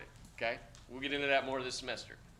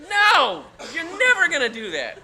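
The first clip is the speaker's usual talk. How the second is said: laughing loud; 14 semitones higher, 16 dB louder, slower than usual. Real, not acted. Teacher laughs, loud denial.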